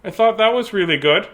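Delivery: plain reading voice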